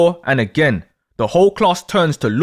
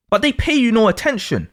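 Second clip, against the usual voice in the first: ordinary delivery, nothing marked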